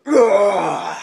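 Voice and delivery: loud, straining roar; mock-monstrous scream, held back for camera; T-Rex roar. Shown as a demonstration. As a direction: let out a beastly roar. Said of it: "giving birth to a roaring T-Rex".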